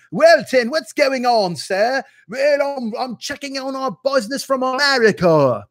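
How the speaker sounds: high-pitched, hammed-up British accent; mock British boss accent. Boss coming in on Hugh Wilton, exaggerated British accent. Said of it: "when I do the accent".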